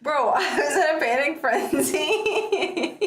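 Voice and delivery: slow words dissolving into high laughter; losing it completely; laughing. Real, not acted. Friend recalling the panic, slow then breaking into high laughter.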